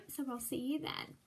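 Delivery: plain reading voice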